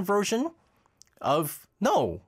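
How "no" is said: normal talking voice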